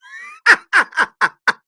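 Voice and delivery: high, loud burst of laughter; helpless high cackle. Real, not acted.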